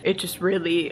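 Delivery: normal talking voice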